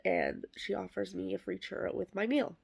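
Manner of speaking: normal talking voice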